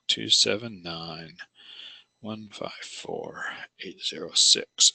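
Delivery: soft, slow muttered digits; muttering to himself. Real, not acted. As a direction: whisper the numbers to yourself as you write. Muttering the code while writing.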